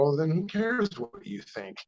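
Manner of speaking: high, cocky brush-off; retelling a snappy put-down; dismissive retort. Retold or imitated. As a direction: shut him down with a smirk. Retold retort to know-it-all.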